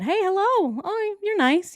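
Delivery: high mock coworker flirting; imagined flirt. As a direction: coo the hello like a charmed coworker.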